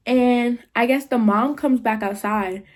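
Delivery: plain reading voice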